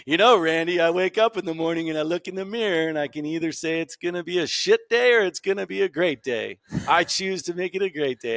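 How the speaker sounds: hamming up a grandpa's morning motto; Grandpa Chuck. Retold or imitated. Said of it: "not to quote Grandpa Chuck, but here I go".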